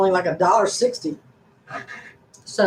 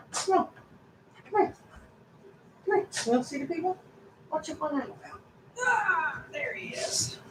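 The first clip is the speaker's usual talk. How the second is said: high, soft sing-song voice for the dog; 9 semitones higher, 13 dB quieter than usual. Real, not acted. To her whining dog, a high soft coo. Talking baby talk to the dog.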